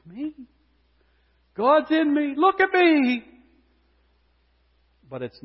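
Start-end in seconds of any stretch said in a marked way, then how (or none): 0.1-3.2 high, loud, preening voice; a loud, hammed-up boast; mock self-admiration. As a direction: parody the smug believer. Mocking an egocentric believer.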